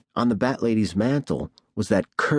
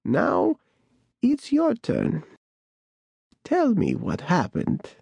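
put-on creaky old voice; 8 semitones higher, slower than usual. A narrator's reading. Bat Lady demands.

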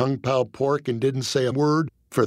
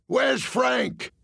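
high, shouted heckle from the crowd; heckler's yell; 11 semitones higher than usual. Retold or imitated. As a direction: yell it from the back of the room. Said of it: "a large fellow with no neck yelled".

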